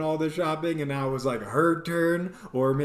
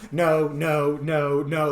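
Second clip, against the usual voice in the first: no train; 4 dB louder, slower than usual. Retold or imitated. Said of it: "went the no train from hell".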